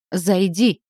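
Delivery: loud rumbling call; booming command. A narrator's reading. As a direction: boom out the order. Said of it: "громко пророкотал".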